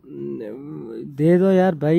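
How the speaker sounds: very slow, begging drawl; drawn-out plea. Real, not acted. Pleading to ride the bike. (धीमी, खिंची हुई विनती)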